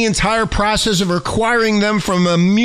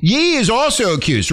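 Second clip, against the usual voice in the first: ordinary talk, nothing marked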